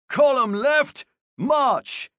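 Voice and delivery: high, loud drill command; shouted order. A narrator's reading. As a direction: call it to the whole column. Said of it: "Corporal Cicera ordered".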